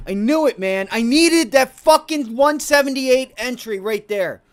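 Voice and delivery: yelling at the missed entry; frustrated yelling. Real, not acted. Trader yelling at himself over a missed entry, loud frustration.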